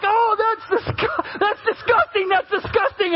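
high-pitched imitation of revolted onlookers; mock disgust. Hikers watching the pastor eat moose poop, hammed-up disgust.

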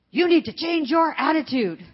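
put-on loud, high little-boy command; toddler's bossy scolding. Toddler on the couch scolding Grammy, loud and high. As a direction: scold like a bossy two-year-old.

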